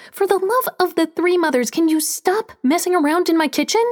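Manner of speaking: high, sharp angry cry; angry outburst. A narrator's reading. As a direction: snap at him in exasperation. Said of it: "and something snapped".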